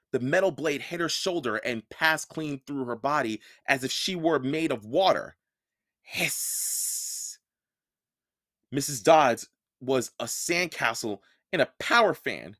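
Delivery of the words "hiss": hiss sound. Acted. Monster dissolving sound effect.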